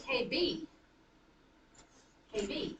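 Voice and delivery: "K B" with the weight on B; iambic stress. Shown as a demonstration. Teacher tests Katie as an iamb, stressing B in "K B". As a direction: lean on the B each time in "K B". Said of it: "gonna do an iambic pattern".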